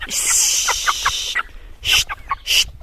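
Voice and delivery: whispered shushes to the birds; shushing. Real, not acted. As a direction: hush the hens gently. Calme les poules. (chuchotement pour calmer)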